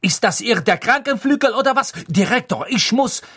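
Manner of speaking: high, loud, angry complaint; outraged scolding; irate. A narrator's reading. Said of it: "sagte Madame Pomfrey erzürnt".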